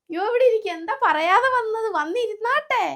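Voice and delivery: high, loud, fussing welcome; fussing grandma. Acted. Grandmother gushing over her granddaughter, high and loud. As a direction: gush over the arrival.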